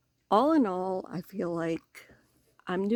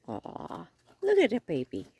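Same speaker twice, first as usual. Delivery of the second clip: cooing; 2 semitones higher than usual. Real, not acted. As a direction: coo at something adorable. Cooing at a baby raccoon.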